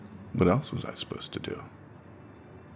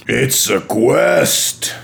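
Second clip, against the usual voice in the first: much higher, louder, slow roaring wolf; wolf's roar; 9 semitones higher, 10 dB louder, much slower than usual. Acted. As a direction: bellow it out slow like an offended beast. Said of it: "I can't quite do the voice".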